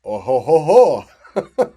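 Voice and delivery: loud "oh ho ho" belly laugh; delighted laugh. Real, not acted. Teacher reacting to the AI's French accent, delighted laugh.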